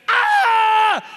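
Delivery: high, loud cry of pain from a kick; pained yelp. Retold or imitated. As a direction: yelp as if kicked in the shin. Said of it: "he's like".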